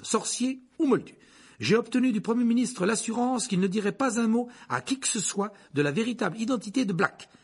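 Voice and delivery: high, emphatic bulletin delivery; newspaper report read aloud. Acted. Harry reading the front page by candlelight, urgent report. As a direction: declaim the alarming news report.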